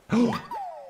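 high, sharp gasp; startled happy intake of breath; surprised gasp. Real, not acted. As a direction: gasp at a lucky find. Digs up communicator part.

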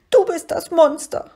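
high, loud tearful creature retort; schniefend. A narrator's reading. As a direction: sniffle it back, high-pitched and hurt. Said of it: "schniefte das bleiche Wesen". (hoch und weinerlich geschnieft)

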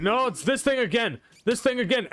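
high-pitched dismayed alarm. Real, not acted. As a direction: cry out in alarm, pitch jumping up. Same enemy attacks the player again.

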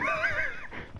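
high laugh. Real, not acted. Laughing during chase.

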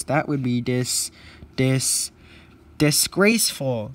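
loud, halting outrage; indignant stammer. Acted. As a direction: stumble on the first syllable, then burst out loudly. Indignant engine stammers over the word.

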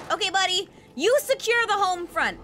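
ordinary talk, nothing marked

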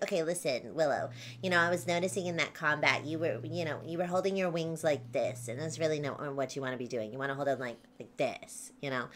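a sprite correcting Willow's wing posture after combat; corrector sprite voice. Acted. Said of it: "so she talks like this a lot".

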